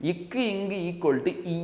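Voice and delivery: slow demonstration. Shown as a demonstration. Teacher spells out sounds slowly.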